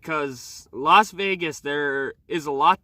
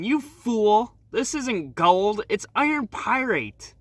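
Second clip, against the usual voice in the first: mocking reply; 7 semitones higher, slower than usual. A narrator's reading. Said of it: "laughs before replying".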